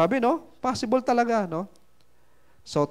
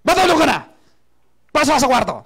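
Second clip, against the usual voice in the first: angry yell; 6 semitones higher, 3 dB louder than usual. Retold or imitated. Father yells child to bed.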